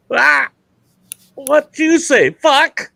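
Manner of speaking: very high, loud punchline squawk; squawking parrot. Acted. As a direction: screech it like a startled parrot. Parrot shouts at frozen turkey.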